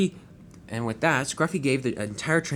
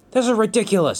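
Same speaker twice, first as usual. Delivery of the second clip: sharp indignant outburst, higher and louder; angry; 9 semitones higher, 5 dB louder than usual. Acted. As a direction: snap it out in outrage. Angry truck shouting.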